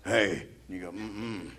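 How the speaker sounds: low, creepy introduction voice; deep creepy voice. Shown as a demonstration. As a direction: drop the pitch like a creep introducing himself. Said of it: "The voice gets deep".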